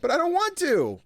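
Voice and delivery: put-on very high whining boy; whiny kid. Small son whining at mother, very high kid voice.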